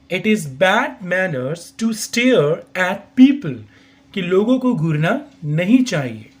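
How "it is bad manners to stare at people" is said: same slow, careful model reading; slow repeat. Shown as a demonstration. Teacher repeats the sentence slowly for learners. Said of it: "I repeat".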